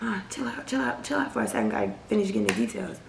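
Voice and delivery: soft, fast whisper telling mom to calm down; hushed plea. Retold or imitated. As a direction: hush her quickly so nobody hears. Hushing mom on the phone.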